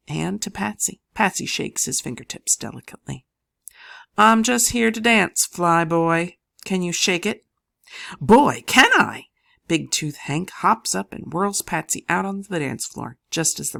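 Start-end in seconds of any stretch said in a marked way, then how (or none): 4.2-7.5 louder, higher sassy tease; Patsy daring the flyboy to dance; flirty challenge. A narrator's reading. 8.2-9.3 much louder, higher, slowed whoop; eager exclamation. A narrator's reading. Hank eager reply.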